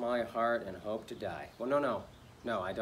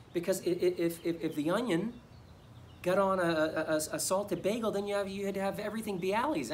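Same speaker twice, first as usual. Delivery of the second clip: high, stammering agitation; flustered fretting; 7 semitones higher than usual. Acted. Bagel driver explaining a disaster to avoid, flustered. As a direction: fret in a raised pitch, tripping over words. Frets over mixed-up bagels.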